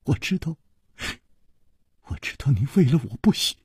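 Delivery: soft, tearful halting words; grief-stricken, broken murmur; mournful. A narrator's reading. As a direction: say it sorrowfully, close to tears. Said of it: "他凄然说".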